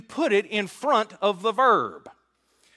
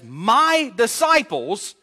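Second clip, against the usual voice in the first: "my disciples" slow and louder, leaning on "my"; stressed phrase; 5 semitones higher, 5 dB louder, much slower than usual. Shown as a demonstration. Preacher stressing Jesus' own words. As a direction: draw out "my disciples", pressing "my". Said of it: "speaking of them as".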